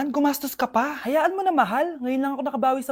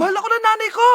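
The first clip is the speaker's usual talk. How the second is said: upset insistence; 8 semitones higher, faster than usual. Acted.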